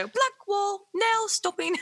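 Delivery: high, slow station announcer voice; automated train announcement. Retold or imitated. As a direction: mimic the recorded tube voice. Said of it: "my impression of it".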